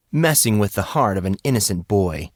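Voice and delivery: usual speaking voice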